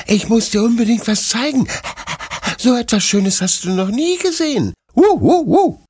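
excited puppy. A narrator's reading. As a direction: yip it out, too thrilled to stand still. Excited puppy shows discovery.

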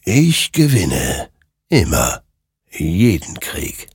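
slow, deliberate otherworldly voice; portentous, measured boast; triumphant creature. Acted. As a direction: pronounce it word by word, with finality. Creature claims every victory.

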